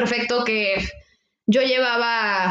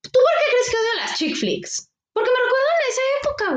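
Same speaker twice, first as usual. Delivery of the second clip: exaggerated; 13 semitones higher, faster than usual. Real, not acted. Joking about hating chick flicks.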